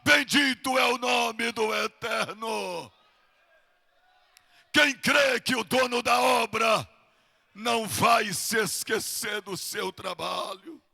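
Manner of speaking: loud, driving pulpit delivery building to shouts; rousing revival-preacher cry; full-force preaching. Real, not acted. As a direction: keep raising pitch and volume phrase by phrase.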